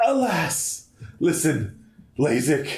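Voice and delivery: high, gasping melodramatic delivery; dramatic game character. Acted. Gamer voicing on-screen dialogue, high melodramatic voice. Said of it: "I guess we gotta do voices".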